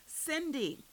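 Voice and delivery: high, distressed cry; screamed name. A narrator's reading. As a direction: call out for a friend being taken. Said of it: "Maxine screamed".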